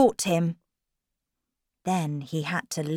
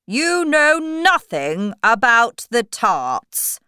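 slow, loud, raised interrogation; storybook king; 6 semitones higher, 8 dB louder, slower than usual. Acted. King cross-examining Alice, slow loud regal voice. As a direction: press the point slowly and loudly. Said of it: "the king asked Alice".

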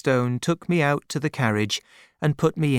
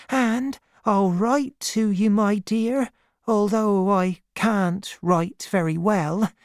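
high-pitched, loving woman's voice; devoted nursemaid Peggotty, earnest; 9 semitones higher than usual. A narrator's reading. Peggotty promises to look after Davy's mother, lovingly.

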